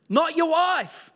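usual speaking voice

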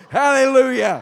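loud, ringing hallelujah; exultant shout. Real, not acted. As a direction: throw it out to the whole church. Preacher's exultant cry.

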